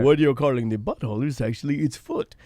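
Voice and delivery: low, soft, patient expert voice; imitating the fish guy. Fish guy correcting the two men, low and calm. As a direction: correct them calmly from way down low. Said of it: "he's like".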